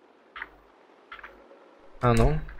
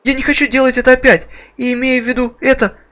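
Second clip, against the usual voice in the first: put-on high, loud, panicked girl; 10 semitones higher, 3 dB louder than usual. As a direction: cry out as a terrified girl. Voices the girl character.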